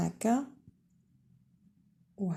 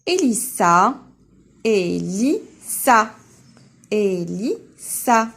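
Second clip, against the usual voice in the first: "Elissa" stretched very slowly, louder; slow, louder model of "Elissa"; slow syllabified name; 4 dB louder, much slower than usual. Shown as a demonstration. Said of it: "teacher models name".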